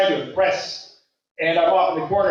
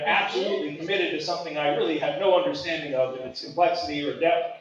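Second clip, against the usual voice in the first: ordinary talk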